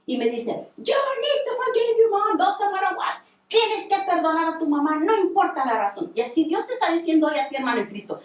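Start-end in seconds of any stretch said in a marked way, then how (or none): 0.8-6.1 high, emphatic imitation of being yelled at; insistent mock shout of command; retold scolding yell. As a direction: act out a stern telling-off, pitch raised, volume only mildly up. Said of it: "me da una gritada".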